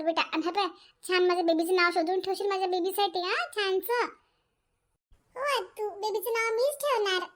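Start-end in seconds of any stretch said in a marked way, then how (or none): 5.4-7.3 put-on child's voice. Daughter pleads with her mother, slow and high like a child. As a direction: whine sweetly like a small girl.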